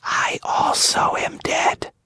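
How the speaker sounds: put-on barely audible, like a dying girl; weak whisper. A narrator's reading. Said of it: "she whispered".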